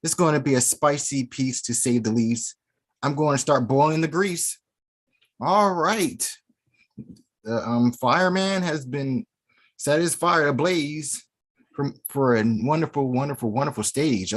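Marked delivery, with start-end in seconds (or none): none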